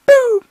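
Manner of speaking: sudden high yelp; mock boo. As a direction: pop out a loud high boo. Mock gunshot sound.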